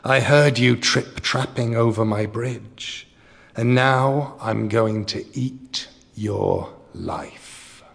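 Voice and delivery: slow, airy troll voice; drawn out like wind through a bridge; slow windy troll voice. Acted. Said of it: "in a voice like the wind".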